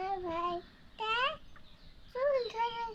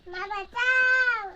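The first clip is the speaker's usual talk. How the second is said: slow, loud drawn-out cry for mum; calling for help; 7 dB louder, much slower than usual. Acted. Trapped daughter crying out to mum, slow and loud. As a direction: wail for mum from inside. Girl trapped calls mother.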